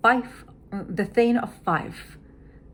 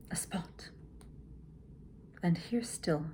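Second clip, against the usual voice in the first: whisper; 10 dB quieter than usual. Shown as a demonstration. Teacher whispering Lady Macbeth's line.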